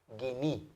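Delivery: guiní said softly, with the ita sounding i; Greek word demo. Shown as a demonstration. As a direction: model guiní for the class, gui-ní. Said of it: "Y la pronunciación de la ita también". (pronuncia guiní suave, la ita como i)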